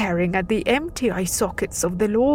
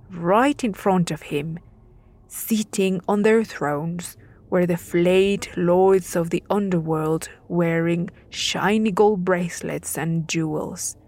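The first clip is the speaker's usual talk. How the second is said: ordinary delivery, nothing marked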